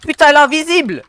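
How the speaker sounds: high, loud outburst of disbelief; shocked shout. Real, not acted. Gamer seeing the opponent turn invisible, shocked shout. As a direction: yell it in sheer surprise.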